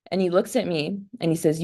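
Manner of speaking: ordinary talk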